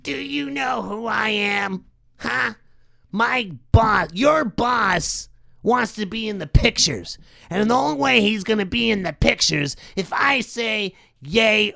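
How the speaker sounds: a hint of a smug bully producer; Harvey Weinstein impression. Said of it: "That's my Harvey Weinstein impression".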